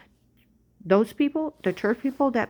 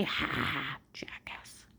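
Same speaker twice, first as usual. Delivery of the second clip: scornful aside; 5 semitones lower, 10 dB quieter, slower than usual. Real, not acted.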